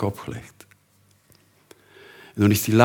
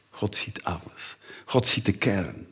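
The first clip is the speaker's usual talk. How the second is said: much softer whisper; hushed; 8 dB quieter than usual. Acted. Preacher reminds the church God sees all, whispered. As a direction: whisper it with weight.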